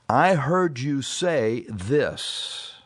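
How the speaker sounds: very slow, deliberate delivery; slow, careful clarifying. Acted. Modelling how to check with a spouse mid-argument, slow and careful. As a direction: draw each word out carefully to check what was heard.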